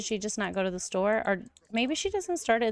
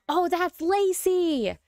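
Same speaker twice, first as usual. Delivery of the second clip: high, slow delighted gasp of a name; excited recognition; 10 semitones higher, much slower than usual. Pretend. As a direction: squeal the name like spotting a friend.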